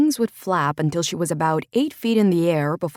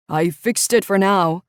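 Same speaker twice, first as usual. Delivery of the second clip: called out, louder; calling out; 3 semitones higher, 6 dB louder than usual. Acted. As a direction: call it across the dark. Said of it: "he called out to Courtney".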